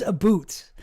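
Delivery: "a boot" for about, punched loud; mock Canadian "about". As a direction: hit about as an exaggerated "a boot". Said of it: "like he was Canadian".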